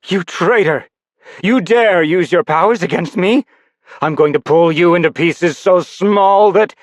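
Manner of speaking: high, loud, raging outburst at a traitor; furious threat. Acted. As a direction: spit it out in rage, cut off at the end.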